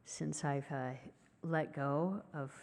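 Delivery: ordinary talk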